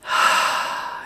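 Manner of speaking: long audible sigh out; centering out-breath; demonstrated exhale. Shown as a demonstration. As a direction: let the breath go in a soft sigh. Models breathing out in exercise.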